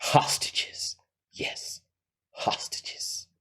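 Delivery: whispered villainous reveal; dramatic whisper. Acted. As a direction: whisper it like the key to the plan. Villain reveals the key element.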